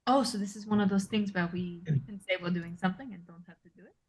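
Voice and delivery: hushed in-character question to a friend; whispered aside. Acted. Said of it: "I'm gonna whisper to Callista".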